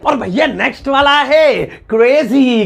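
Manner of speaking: high, slow showman announcing; game-show style reveal; announcer intro. Acted. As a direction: announce the next word like a host. Recurring segment announcer intro.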